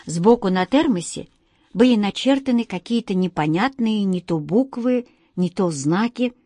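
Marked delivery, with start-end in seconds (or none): none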